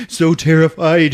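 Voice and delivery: high, loud, frantic telling; hammed-up alarm; terrified narration. A narrator's reading. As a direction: tell it with mock fright in the voice. Narrator acts the fright.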